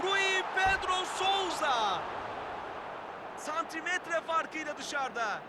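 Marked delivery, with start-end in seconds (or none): 0.0-2.0 shouted name. Real, not acted. Near miss, commentator shouts name.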